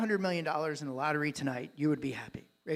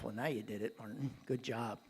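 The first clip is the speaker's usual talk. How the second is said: sarcastic inner voice; 7 semitones lower, 8 dB quieter than usual. Retold or imitated. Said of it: "And I thought to myself".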